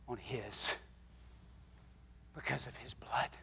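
breathy whispered close. Real, not acted. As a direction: drop to a bare whisper on the blood. Hushed sermon climax.

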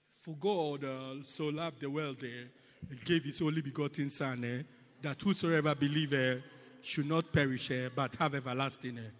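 low, halting mock French accent. Preacher imitating Mister Kelly sharing scripture, mock French accent. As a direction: put on a French accent with "eh" fillers. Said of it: "Speaking English in a French accent".

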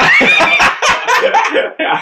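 high burst of shocked laughter; shrieking laugh. Real, not acted. Listener cracking up at the dying-wife punchline, shrieking laugh. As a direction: explode into a laugh. Reaction to heckler story.

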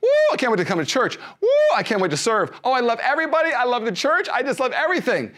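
high, loud and giddy, bursting with excitement. Retold or imitated. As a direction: squeal it like an overeager convert.

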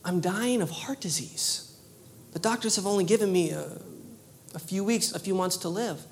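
soft, breathy telling of grave news; hushed whisper. Retold or imitated. Woman tells the preacher her diagnosis, in a whisper. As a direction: whisper it like a dying woman confiding. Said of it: "she says".

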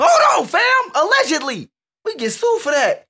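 alarmed shout. Real, not acted. Alarmed warning about defamation.

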